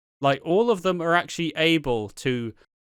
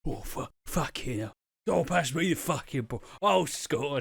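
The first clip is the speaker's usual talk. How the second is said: lightly voiced Danny Dyer. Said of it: "chundle around the fucking pitch".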